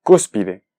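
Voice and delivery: cúspide as CÚS-pi-de, said on its own; cúspide with clear first-syllable stress. Shown as a demonstration. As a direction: stress CÚS in "cúspide", let the rest fall. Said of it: "es una palabra esdrújula".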